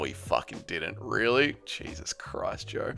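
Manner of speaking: a breathy whispered groan; under-the-breath exasperation; exasperated whisper. Real, not acted. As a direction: whisper it in disbelief. Exasperated at a false quote.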